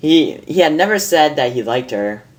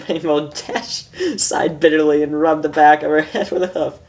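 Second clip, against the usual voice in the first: usual speaking voice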